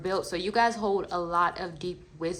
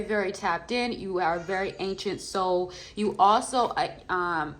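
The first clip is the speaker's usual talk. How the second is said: ordinary delivery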